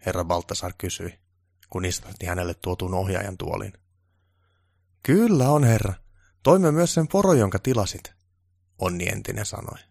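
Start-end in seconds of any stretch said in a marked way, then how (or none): 5.0-8.1 high, louder keen reply; eager to please; keen assistant voice; eager helper. Acted. Onni reports eagerly.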